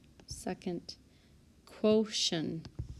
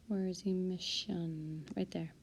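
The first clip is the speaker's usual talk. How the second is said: whisper; 7 dB quieter than usual. Real, not acted. Spotting word on the sheet.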